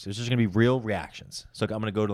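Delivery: usual speaking voice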